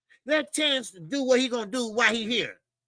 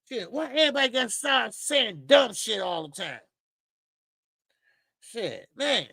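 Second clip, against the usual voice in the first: ordinary delivery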